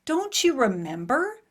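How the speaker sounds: slow, lightly voiced sweet mom; a hint of a sweet mother, drawn out; sweet mother quote. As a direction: mimic mom gently reminding her. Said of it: "my sweet mother piped up and said".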